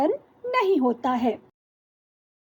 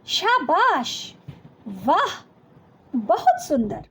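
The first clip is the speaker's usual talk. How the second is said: drawn-out praise; slower than usual. Shown as a demonstration. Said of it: "प्रशंसा सूचक".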